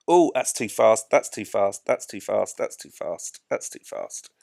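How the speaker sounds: anxious repetition, dropping away in pitch and volume; mounting worry, fading. Real, not acted. Panics at approach, repeats, fading.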